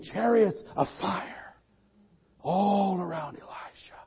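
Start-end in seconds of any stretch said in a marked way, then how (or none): 2.4-4.0 hushed breathless whisper; preacher whispering the vision in awe; awed whisper. Acted. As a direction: breathe it out in wonder.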